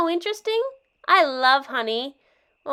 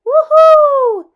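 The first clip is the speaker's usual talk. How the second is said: loud, high joyful woohoo; excited whoop; 10 semitones higher, 11 dB louder than usual. Acted. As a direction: yell with thrill on the slide. Kid whooshing down water slide.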